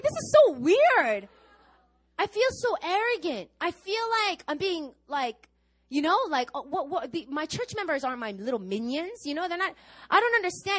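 exasperated high-pitched complaint; flustered protest. Retold or imitated. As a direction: blurt out the discomfort, rising. Her own retold distress.

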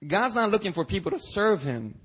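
ordinary delivery

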